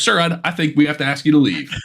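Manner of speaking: mock-polite ejection, cracking up; campground stand-up comic. Comic asks a whooping heckler to leave, laughing put-on voice. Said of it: "he'd be like".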